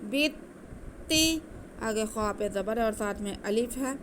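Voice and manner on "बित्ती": slow, drawn-out melodic tajweed recitation, higher and louder; Quran recitation. Shown as a demonstration. Teacher demonstrating the recitation to students, slow melodic chant. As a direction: chant it slowly with nasal ghunna. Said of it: "खींचना नहीं है ता को".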